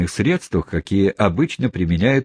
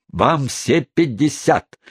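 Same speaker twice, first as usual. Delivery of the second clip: taunting retort; 6 semitones higher, slower than usual. A narrator's reading. Wardle taunting his sister.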